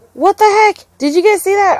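jolted by a surprise attack; stunned gamer calling to chat; shocked. Real, not acted. Enemy suddenly attacks.

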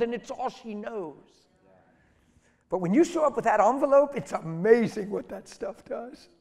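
loud, high, full-force sermon delivery; impassioned preaching. Real, not acted. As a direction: preach it hard, driving each phrase.

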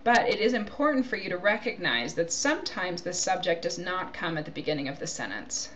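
ordinary talk

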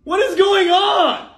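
loud, high, slow outburst of disbelief; drawn-out loud what-is-happening cry; exasperated shout. Acted. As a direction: throw the line up in loud exasperation. Boyfriend baffled by sudden sulking.

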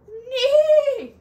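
high whinny on neighed; whinny the word; horse neigh. Acted. Horse sound imitated.

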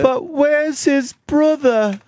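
high, slow quoted line; movie line quote. Retold or imitated. Host teasing the caller by quoting a film line, high and slow. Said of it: "I'm just quoting lines from a movie".

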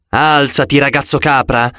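usual speaking voice

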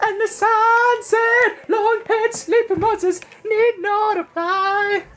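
high, slow laughing narration. Acted. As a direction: giggle through a mock announcement.

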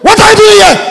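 put-on high loud bark at a young man; intimidating shout. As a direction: shout him down like a bullying cop. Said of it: "They want shout to intimidate you".

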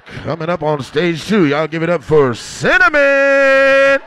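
booming MC intro; strip club MC. Pretend. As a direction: announce her like a club DJ. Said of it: "up next".

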